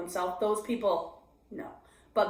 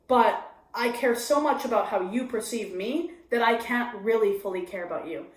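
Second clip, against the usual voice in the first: ordinary delivery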